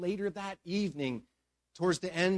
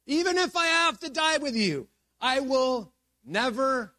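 emphatic, high, loud vow; Peter; 8 semitones higher, 4 dB louder than usual. Acted. Peter insists to Jesus that he will stay loyal, emphatic. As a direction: insist as if slamming the table. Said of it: "Peter insisted emphatically".

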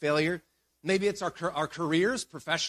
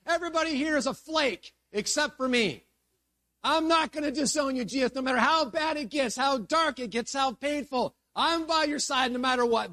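heart-led, impassioned high-pitched protest; Peter; 8 semitones higher than usual. Acted. Peter stands up to promise Jesus loyalty, impassioned. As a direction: vow loyalty with heart ahead of head. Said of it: "led by heart, not by head".

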